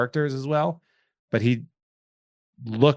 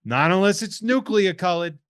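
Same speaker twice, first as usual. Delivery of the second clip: higher, louder hammed-up New Jersey voice; mock Jersey accent; 7 semitones higher, 4 dB louder than usual. Mocking hot dog makers in a put-on Jersey accent. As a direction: play a brash Jersey guy. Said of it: "a Jersey accent on that one".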